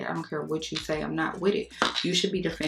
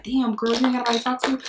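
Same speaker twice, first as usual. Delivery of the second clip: put-on exasperated girl; 5 semitones higher, 3 dB louder, faster than usual. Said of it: "But other times I be like".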